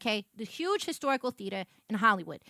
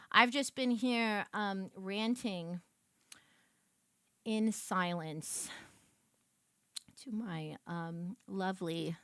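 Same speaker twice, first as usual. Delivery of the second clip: slow, soft doting tone; slow, softer fond murmur; tender aside; 6 semitones lower, 7 dB quieter, much slower than usual. Real, not acted. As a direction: coo at the sweet gremlins. Mock sweet slow confession.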